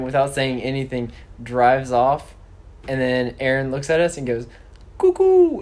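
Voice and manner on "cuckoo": high, loud sing-song cuckoo; playful cuckoo. Retold or imitated. Aaron teasing the boys before driving off. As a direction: toss off a silly bird call. Aaron's parting word to them.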